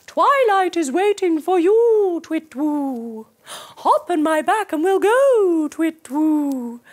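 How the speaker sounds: put-on a friendly owl calling the children; gentle owl voice ending in a hoot; owl character. A narrator's reading. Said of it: "he says".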